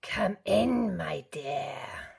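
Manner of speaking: whispered scratchy wolf; hushed scratchy wolf voice; deep scratchy voice. A narrator's reading. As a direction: lure her in, hushed. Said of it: "a deep, scratchy voice".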